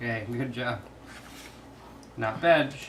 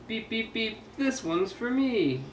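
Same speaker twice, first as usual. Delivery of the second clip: high, slow happy beeps; excited truck voice; 10 semitones higher than usual. Acted. Truck horn in read-aloud.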